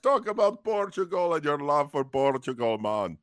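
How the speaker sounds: laid-back fake Jamaican, hamming it up; mock Jamaican accent. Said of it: "doing a Jamaican accent".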